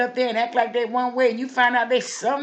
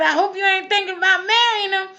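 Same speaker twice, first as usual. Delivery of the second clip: high, loud call of caution; loud warning; 10 semitones higher, 5 dB louder than usual. Pretend. Old lady hollers warning to Miss Anna Mae, high and loud. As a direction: shout a warning down the street. Calling warning to neighbor woman.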